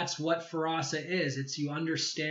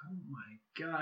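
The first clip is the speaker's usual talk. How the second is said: whispered oh my god, caught off guard; shocked whisper; 8 dB quieter than usual. Retold or imitated. Recalling the face reader's revelation, he whispers in shock. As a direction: breathe it out as a stunned whisper.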